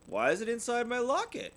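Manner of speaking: high curious little voice; wondering child. Acted. Sister asking her parents about the locket, high and curious. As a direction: ask it wide-eyed. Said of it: "she wondered".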